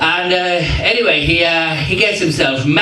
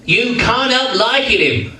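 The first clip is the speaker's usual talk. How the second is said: higher-pitched music-hall catchphrase; Max Miller catchphrase; 5 semitones higher than usual. Retold or imitated. Comic quizzes the audience on a catchphrase, in impression. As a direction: toss it out as a comedian's signature line. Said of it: "guess who this next catchphrase is from".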